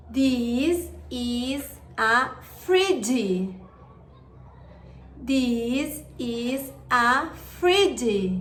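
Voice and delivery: slow, clear teacher modeling; slow model sentence. Shown as a demonstration. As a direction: say it slowly for kids to copy. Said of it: "Now listen and repeat".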